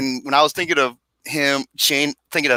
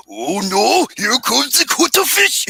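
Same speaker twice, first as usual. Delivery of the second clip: higher mock South Park accent, rising at the end; 9 semitones higher, slower than usual. Friend quoting a South Park bit to the group, mock accent.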